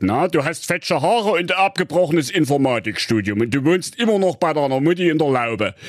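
hohe, aufgebrachte Königsstimme; wütender König. Acted. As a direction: schimpfender Märchenkönig, der seine Tochter zurechtweist. König verhört Freier. (der König schimpft empört mit seiner Tochter)

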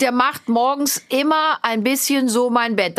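slow, with "immer" leaned on and drawn out; stress on immer. Shown as a demonstration. Mother repeating her daughter's sentence, leaning on "immer". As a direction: slowly repeat it, landing hard on "immer". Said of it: "Betonung liegt".

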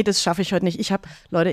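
normal talking voice

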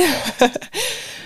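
high laugh. Real, not acted. Laughs at retold argument.

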